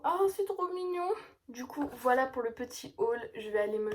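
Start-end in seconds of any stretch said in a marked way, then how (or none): 0.0-1.1 high-pitched adoring coo; melting, high little voice; cooing delight. Real, not acted. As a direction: coo over something cute. Cooing over the cute book.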